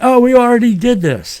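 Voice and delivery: high-pitched classroom complaint; mock student protest. Students objecting to the sub's assignment, mock high-pitched protest. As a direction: whine it like a pupil dodging work. Acting students' excuse to substitute teacher.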